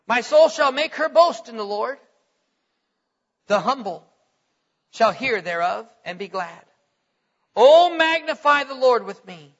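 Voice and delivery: declaiming the psalm with rising fervor; scripture reading. Acted. Preacher reading Psalm thirty-four to the congregation, fervent. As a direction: read it like a preacher lifting the words.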